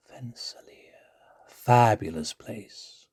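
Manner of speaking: normal talking voice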